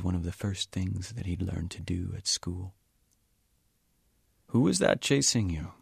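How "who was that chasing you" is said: put-on higher, louder old man's bemused question; an elderly man surprised by the odd accusation; puzzled old Sikh. Old Sikh asks Lowboy, bemused and higher voiced.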